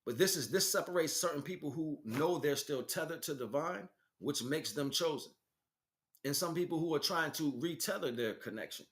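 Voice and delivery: normal talking voice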